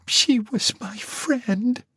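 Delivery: high, slow, tearful. Acted. Marcy admitting to Danny, slow and tearful. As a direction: let the tears into it. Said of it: "starting to weep".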